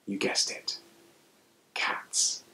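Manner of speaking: playful storyteller whisper. A narrator's reading. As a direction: whisper the punchline to the listener. Repeated playful aside.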